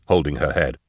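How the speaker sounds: ordinary delivery, nothing marked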